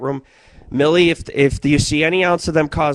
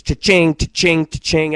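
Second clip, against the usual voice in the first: cha-ching; 2 semitones higher than usual. Retold or imitated. Slot machine sound.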